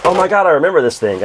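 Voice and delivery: loud, high mock gasp of recognition; excited recall. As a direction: relive the thrill of spotting an old favourite. Retelling finding a Virtual Boy.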